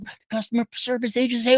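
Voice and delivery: ordinary talk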